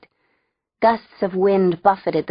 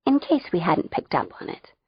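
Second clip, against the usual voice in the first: ordinary delivery, nothing marked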